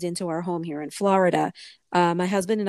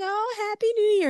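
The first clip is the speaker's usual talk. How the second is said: high-pitched retold holiday greeting; singsong, excited imitation; cheery greeting. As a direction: greet a caller with bubbly cheer.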